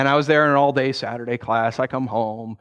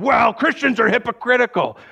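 mock indignant; 8 semitones higher, 4 dB louder than usual. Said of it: "angry man".

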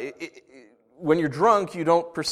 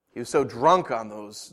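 usual speaking voice

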